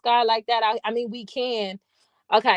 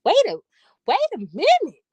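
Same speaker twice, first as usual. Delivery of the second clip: high, louder double take; mock disbelief; 9 semitones higher, 4 dB louder than usual. Reacting to how he said water, played-up disbelief. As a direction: halt him in high-pitched, played-up bafflement.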